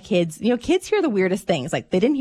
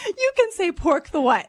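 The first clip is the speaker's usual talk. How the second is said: excited; 10 semitones higher than usual. Real, not acted. Mom exclaiming the punchline to co-host John. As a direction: shout the punchline in disbelief. Retelling punchline, laughing loudly.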